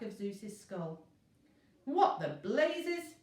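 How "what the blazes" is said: high, loud slow indignant shout. A narrator's reading. Zeus shouting after the parchment hits his skull, outraged. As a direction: bellow in outrage at being hit. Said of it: "shouted Zeus".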